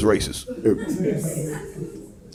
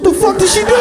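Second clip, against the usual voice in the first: much higher outraged yell; shocked yell; faster than usual. Retold or imitated. Dad answering the school's call, shocked yell. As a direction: bark it at the school. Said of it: "I said".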